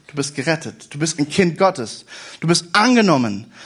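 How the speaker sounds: ordinary delivery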